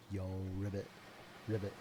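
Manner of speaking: croaky little frog voice, voicing the frog; a playful frog greeting. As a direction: say ribbit as the frog would.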